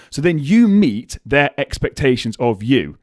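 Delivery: ordinary talk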